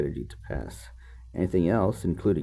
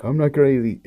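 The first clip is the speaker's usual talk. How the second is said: higher, louder mock defensive voice; mock insistent "I'm not crazy"; 5 semitones higher, 4 dB louder than usual. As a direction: play the author protesting that he's sane. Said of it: "It's like".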